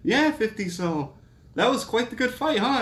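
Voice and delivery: high, chirpy prodding interview voice; egging on a guest for an answer; mock interviewer. Podcaster mimicking a ringside interviewer, high and chirpy. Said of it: "ask him a question and be like".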